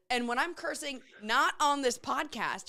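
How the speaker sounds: usual speaking voice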